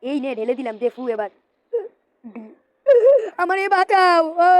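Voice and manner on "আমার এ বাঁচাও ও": high, loud cartoon scream for help; cry for help. Character attacked by the others, high screaming for help. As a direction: scream for rescue in a squeaky voice.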